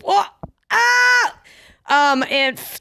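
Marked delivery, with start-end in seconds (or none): 0.7-1.3 sharp high yelp of disbelief; shocked yelp. Real, not acted. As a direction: yelp in real shock at the question. Shocked at question about butter beans.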